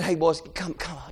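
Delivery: low, very soft aside; hushed crowd voice. Acted. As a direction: play a bystander quietly shushing. Acts crowd hushing the blind men.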